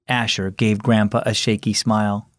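ordinary delivery, nothing marked

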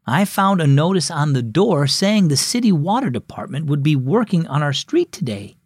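ordinary delivery

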